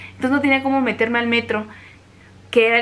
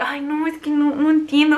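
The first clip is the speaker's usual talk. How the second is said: frustrated lament; 4 semitones higher than usual. Retold or imitated. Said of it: "Y me dio coraje".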